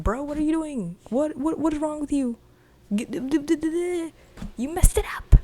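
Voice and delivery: high, loud, exasperated shouting at a screwup; exasperated yell. Retold or imitated. Monica yelling at the agent who ruined it, exasperated shout. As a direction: yell at him like he ruined everything.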